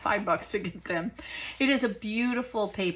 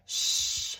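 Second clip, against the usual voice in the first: a whispered shh, then a much softer scolding; mocking hush; 5 dB quieter than usual. The world hushing believers, imitated in a soft whisper. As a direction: imitate someone hushing you for offending. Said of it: "are we being told".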